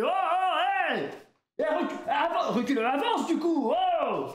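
high-pitched mock panic; alarmed yelling. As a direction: cry out in alarm to stop the driver. Passengers yell at reckless driver.

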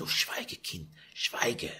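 urgent whisper; whispered hush. Acted. Mother hushing her daughter in a frightened whisper. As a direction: whisper her to silence in fear.

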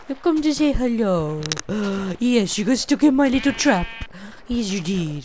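high sing-song voice taunting a trapped mob; cutesy baby talk. Acted. Gamer teasing a trapped mob in a high cutesy voice. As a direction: coo mockingly at the stuck creature.

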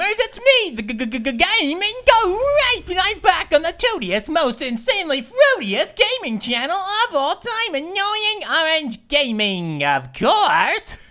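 loud stuttering host welcome; goofy show opener; channel intro. Acted. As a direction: hype up the gamers.

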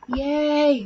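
high, loud yay; kids cheering. A narrator's reading. Boys cheer the assembly, high and loud. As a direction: cheer it like a kid let out of class. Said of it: "me and the guy shouted".

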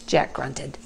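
plain reading voice

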